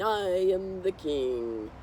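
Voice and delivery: slow, drawn-out royal boast; proud boy king. Pretend. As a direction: declare it grandly to your subjects. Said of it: "Max on top saying".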